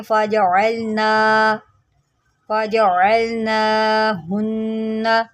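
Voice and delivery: फ़जअलना with the ain from the throat, slow; clear ain. Shown as a demonstration. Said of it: "यहाँ पर आइन निकालें".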